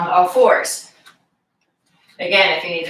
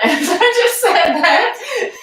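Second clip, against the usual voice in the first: laughing through the words, pitched up; 12 semitones higher, slower than usual. Real, not acted. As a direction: break into a real laugh mid-phrase. Laughs at own accent slip.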